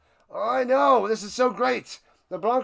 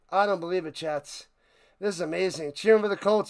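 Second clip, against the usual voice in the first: ordinary talk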